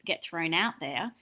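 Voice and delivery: usual speaking voice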